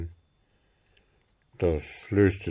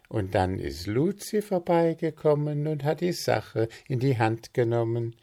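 higher, lightly voiced helpful friend; offering an idea eagerly, a touch higher; Willi chiming in; 6 semitones higher than usual. Friend offers a cake name, lightly voiced higher.

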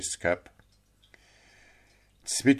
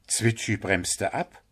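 plain reading voice

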